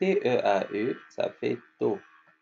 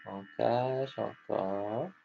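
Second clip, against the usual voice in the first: slow, soft and drawn out; drawn-out soft repeat for the class; 5 dB quieter, much slower than usual. Shown as a demonstration. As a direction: stretch each word for the children. Teacher repeats very slowly, softly.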